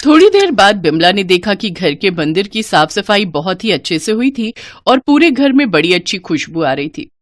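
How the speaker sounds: plain reading voice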